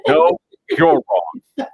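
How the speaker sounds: high, very slow, drawn out with a laugh; mocking retort. Throwing the checkpoint guy's words back, high and drawn-out mockery. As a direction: sing it back at him, gloating.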